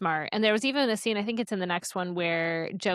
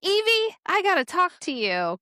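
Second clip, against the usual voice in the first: urgent call; 10 semitones higher, 4 dB louder than usual. Retold or imitated. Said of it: "He's like".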